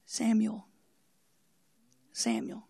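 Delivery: whispered call of a name; hushed voice of God calling; mysterious calling voice. Acted. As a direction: whisper it slowly into the stillness, pausing between calls. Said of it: "suddenly there's a voice".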